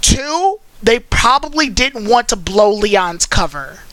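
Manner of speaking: normal talking voice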